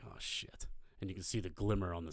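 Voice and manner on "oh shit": whispered curse under the breath; retold sinking realisation, barely voiced; quiet "uh-oh" to himself; hushed dread. Dread as patrol boat approaches.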